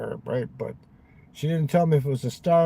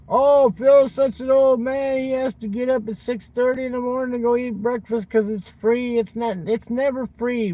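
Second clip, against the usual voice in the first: much higher, louder whiny mimicry; mocking Will's whining; 9 semitones higher, 4 dB louder than usual. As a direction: whine it like the friend who nags him. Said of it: "remember how he used to bitch at me".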